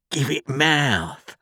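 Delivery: slow, drawn-out high order; menacing convict dragging out a command; demanding convict. A narrator's reading. Convict orders boy to speak up.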